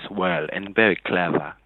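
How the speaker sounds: low mock British accent; imitated British accent. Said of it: "I can also do your imitation of the voice".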